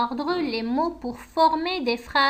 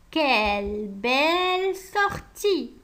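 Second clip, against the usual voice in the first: slow dictation; slower than usual. Acted. Reading out the corrected sentence.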